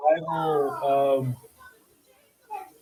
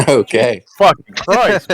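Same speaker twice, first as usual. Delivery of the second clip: laughing; 12 dB louder than usual. Real, not acted. Reacts to absurd temperature.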